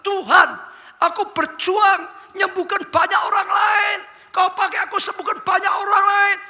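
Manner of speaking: high, loud desperate shout upward; crying out to God. Retold or imitated. Old pastor in a wheelchair crying out to God, high loud shout. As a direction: plead at the top of your voice like a sick old pastor. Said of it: "dia teriak dengan Tuhan".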